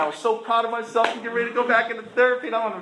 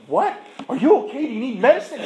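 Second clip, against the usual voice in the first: louder, higher, alarmed questions; freaking out; 6 semitones higher, 6 dB louder than usual. Acted. Therapist told he is sober for a week, freaking out. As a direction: imitate a therapist in a mock panic. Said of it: "She was, like, freaking out".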